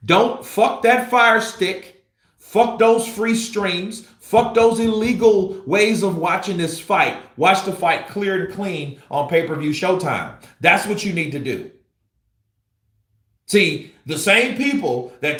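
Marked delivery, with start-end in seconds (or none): 0.1-9.7 angry rant. Real, not acted. Vlogger scolding viewers who stream fights illegally, angry. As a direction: lay into the freeloaders.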